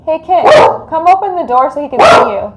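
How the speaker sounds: loud, fast call through the house. Real, not acted. Calling to Kit to open the door so the dog sees him, loud. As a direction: call out to Kit in the next room.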